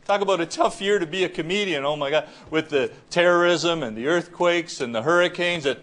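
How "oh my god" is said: ordinary talk, nothing marked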